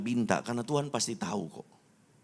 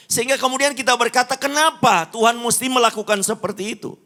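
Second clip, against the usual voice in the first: loud, high-pitched sermon delivery; impassioned preaching; 12 semitones higher, 9 dB louder than usual. Real, not acted. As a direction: preach it at full force, voice lifted high. Preaches at full force. (khotbah berapi-api dengan suara lantang)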